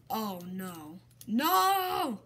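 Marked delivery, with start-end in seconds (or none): 1.3-2.2 loud, high cry of despair; shout. Real, not acted. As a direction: yell at the screen as you lose. Losing the round.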